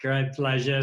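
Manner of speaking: ordinary talk, nothing marked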